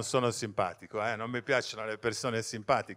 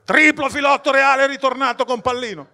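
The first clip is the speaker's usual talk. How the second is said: loud high mock commentator; voice-over narrator; 13 semitones higher, 13 dB louder than usual. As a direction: read it like a game-show voice-over. Fantozzi voice-over line.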